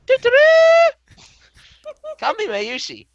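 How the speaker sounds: very high sing-song greeting; bubbly high-pitched anime girl; cute girl voice. Acted. Voicing cheerful girl character.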